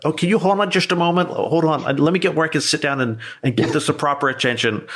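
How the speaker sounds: high, fast, faux-flustered; wasting a scammer's time with a high, hurried fake fluster; mock flustered stalling. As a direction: rush it like a dithering caller.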